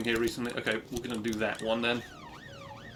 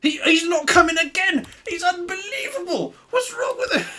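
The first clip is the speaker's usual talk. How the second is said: much higher, louder, hammed-up exasperated squeal; mock outraged shriek; 8 dB louder than usual. Police chief ranting at his useless officer, hammed-up shriek.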